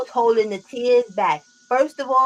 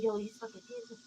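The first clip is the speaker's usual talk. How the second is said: hushed, high squeaky voice; tiny strained voice; mock choked-up; 13 semitones higher, 18 dB quieter than usual. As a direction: imitate holding back tears, very soft and high. Retelling trying not to cry.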